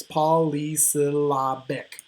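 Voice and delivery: slow, measured polysyllabic, five separate beats; syllable by syllable. Shown as a demonstration. Teacher shows the five syllables, spoken slowly one by one. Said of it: "It has five syllables".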